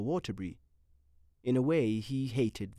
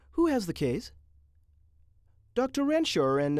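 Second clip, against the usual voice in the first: high, louder nurse voice; pretty nurse; 6 semitones higher, 5 dB louder than usual. A narrator's reading. Nurse announcing a patient, high voice.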